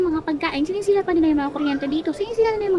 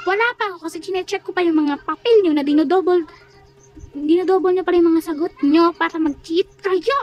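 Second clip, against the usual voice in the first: louder, emphatic teacher's rebuke; forceful telling-off; angry scolding; 5 dB louder than usual. Acted. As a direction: lay into them, hammering the accusations. Teacher scolds pupils for cheating.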